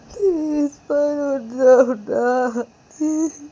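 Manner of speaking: high, loud, stammering plea, slowing at the end; desperate crying plea; tearful begging. Acted. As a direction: beg her to wake through tears. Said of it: "Ananya रोते रोते".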